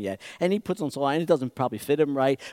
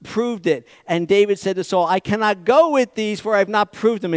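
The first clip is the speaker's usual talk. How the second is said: scripture reading; 4 semitones higher, 6 dB louder than usual. Acted. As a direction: read the verse with weight. Reads Samuel aloud.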